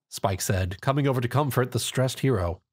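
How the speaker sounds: usual speaking voice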